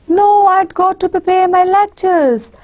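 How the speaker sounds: "No, I'd go to prepare my lectures" held high throughout; stressed reply. Shown as a demonstration. Lecturer turning down a friend's movie invitation, stressed reply. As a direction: push the whole line "No, I'd go to prepare my lectures" up with emphasis. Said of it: "my stress is going to be on the second line".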